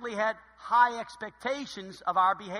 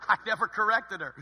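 normal talking voice

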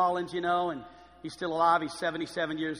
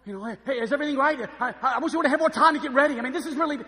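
loud, higher, fussing over appearances; flustered host; 5 semitones higher, 7 dB louder, faster than usual. Acted. Host with somebody famous at the table, flustered. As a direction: play a starstruck host in a mock panic. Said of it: "you put somebody famous at the table in your life and you're like".

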